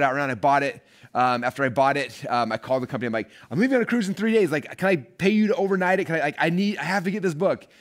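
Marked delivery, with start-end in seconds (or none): 3.5-7.7 higher, louder, urgent pleading; urgent plea. Retold or imitated. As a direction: beg them to rush it, voice up high. Begging company to overnight book.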